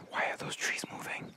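hushed frightened whisper; scared whisper. Real, not acted. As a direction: breathe it out so nothing hears you. Scared in a dark forest.

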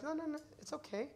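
soft, lightly voiced brush-off; a hint of a brush-off. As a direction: wave the offer away gently.

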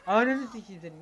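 loud, cursing snap at the screen; sudden angry outburst; angry yell. Real, not acted. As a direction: yell at the noisy character. Swearing at a yelling game character.

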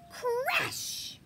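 high, loud crash call. Acted. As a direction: burst out the noise word. Reading a sound word.

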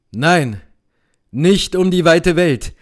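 mild cry. Acted. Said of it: "rief Benedikt".

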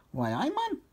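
high, curious exclamation; Geordie character. A narrator's reading. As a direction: call attention in a high Geordie voice. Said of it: "said Geordie Steve".

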